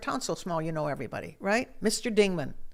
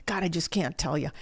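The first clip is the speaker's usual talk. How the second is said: ordinary talk, nothing marked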